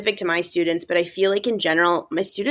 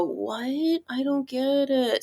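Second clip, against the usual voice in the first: small, puzzled student voice, a bit higher; confused student; 4 semitones higher, 5 dB quieter, much slower than usual. Retold or imitated. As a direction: mimic a lost teen who doesn't follow the text. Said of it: "they go".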